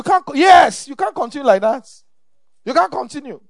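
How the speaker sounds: rising shout, pressing the point home; loud rebuke. Real, not acted. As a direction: rebuke them loudly. Preacher rebuking congregation.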